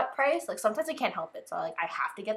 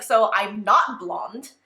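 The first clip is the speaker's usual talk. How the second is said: put-on slow, loud go at his accent; amateur copy of his accent, louder and slower; Chan's accent; 7 dB louder, much slower than usual. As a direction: badly imitate his accent, drawing the words out. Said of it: "I'm terrible doing the same accent".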